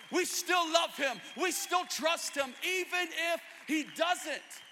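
high, driving, emphatic sermon voice; full-force preaching. Real, not acted. Preacher proclaims defiant faith to church, full-force preaching. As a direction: preach it with mounting conviction, up to a shout.

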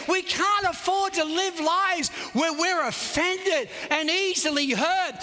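high, driving sermon delivery; preaching at full force. Real, not acted. Preacher urging the congregation to believe God, full-force preaching. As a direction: preach it high with long, pressing phrases.

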